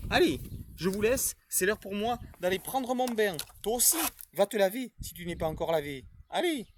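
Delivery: put-on a cheerful character greeting the children; Supermaître puppet voice.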